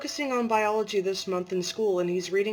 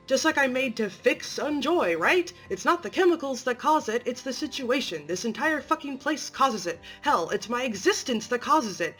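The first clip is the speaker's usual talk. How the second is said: rising, louder and higher, bitter fury; a patient finally snapping at the therapist; angry outburst; 4 semitones higher, 4 dB louder than usual. Acted. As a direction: let the anger spill over and build. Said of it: "I'm really angry at you".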